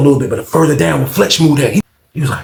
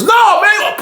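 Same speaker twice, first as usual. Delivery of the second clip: panicked cry. Retold or imitated. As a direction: scream it from offstage. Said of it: "All you heard was".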